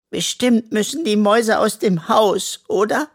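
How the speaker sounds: little raccoon afraid for his mice; worried. A narrator's reading. Henry fears losing the mice.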